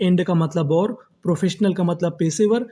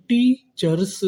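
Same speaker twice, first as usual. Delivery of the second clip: Teachers split slowly as टी, cheers, first part higher; syllable-by-syllable; 4 semitones higher, much slower than usual. Shown as a demonstration. Teacher building teachers for students, slow and split. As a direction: stretch teachers into two separate parts. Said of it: "तो meaning क्या बनी".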